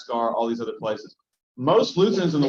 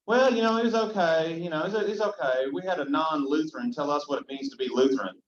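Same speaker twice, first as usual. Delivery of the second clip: put-on Eastern Kentucky accent, pitched higher at the start; imitating a Kentucky woman; 3 semitones higher than usual. Pastor imitating a Kentucky churchgoer on her phone, light Appalachian accent. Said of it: "And I heard her say".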